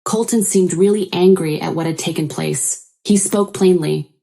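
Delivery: ordinary delivery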